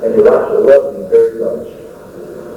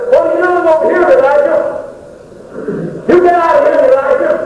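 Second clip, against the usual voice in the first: high-pitched, insistent scolding; emphatic rebuke from God; faster than usual. Acted. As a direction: order him out in a high, urgent voice. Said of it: "I think he emphasized that word".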